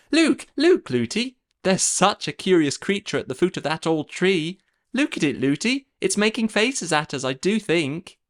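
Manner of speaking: put-on high, chattering young girl; little princess voice. A narrator's reading. Princess calls to her running nurse, high and chattering.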